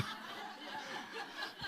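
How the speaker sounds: quiet breathy laugh; a soft laugh out of breath. Real, not acted. Laughs after accent joke.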